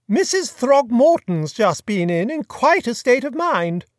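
put-on chatty, high-pitched grand old lady; imperious plummy grande dame. A narrator's reading. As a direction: gossip like a fluttery duchess. Duchess tells, slowly.

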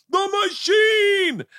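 long drawn-out high shout of the name; shouted nickname. Retold or imitated. Partygoer hailing Bert at the door, drawn-out shout. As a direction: bellow it like a party crowd greeting a legend.

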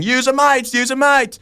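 imitating yourself breaking up a fight; retold peacemaking plea. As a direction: call out over a squabble, hammed up. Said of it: "there I was saying".

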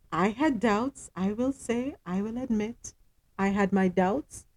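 softly owning up; quiet admission. Real, not acted. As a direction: drop the volume to confess a doubt. Admits her earlier doubts.

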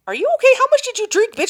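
high-pitched incredulous questioning; hammed-up worried friend, voice way up; imitated Pierre's alarm. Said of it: "Pierre's like".

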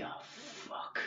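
voiceless, hushed curse; whispered dread. Retold or imitated. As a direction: swear under your breath in a whisper. Reacting to whispered bomb news.

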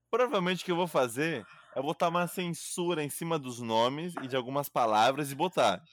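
normal talking voice

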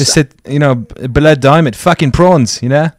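put-on South African accent; rough attempt at South African English. Shown as a demonstration. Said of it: "so the South African one's hard".

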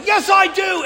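sharp, high defensive snap back; defensive retort. Retold or imitated. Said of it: "it's just a screaming match".

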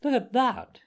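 high-pitched boastful exclamation; proud, high-pitched call; excited cry. A narrator's reading. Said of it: "he cried".

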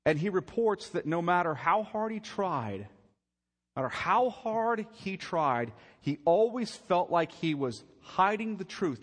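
loud, high preacher's build; preaching at full force. Acted. Preacher pressing the congregation, loud and high. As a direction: drive it home from the pulpit.

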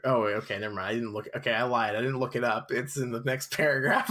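usual speaking voice

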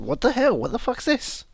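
baffled. Retold or imitated. Audience reaction acted out.

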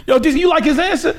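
very high, laughing mock challenge; joking intimidation. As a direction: imitate a playful bully while cracking up. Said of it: "[name], [name] was joking".

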